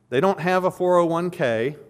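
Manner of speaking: ordinary talk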